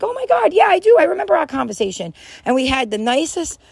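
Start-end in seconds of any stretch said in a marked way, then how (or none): 0.0-2.1 high, delighted recognition; so sweet. Retold or imitated. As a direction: imitate her sweet, thrilled reply. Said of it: "she was so sweet and was like".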